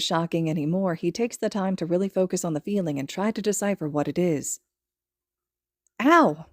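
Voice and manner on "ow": short pained ow, louder; a quick startled yelp of pain; higher yelp; sharp yelp. A narrator's reading. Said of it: "he yelps".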